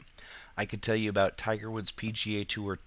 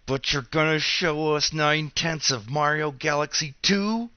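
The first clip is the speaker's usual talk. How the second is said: indignant outburst; 5 semitones higher, 6 dB louder than usual. Real, not acted. Outraged at unfinished game double standard.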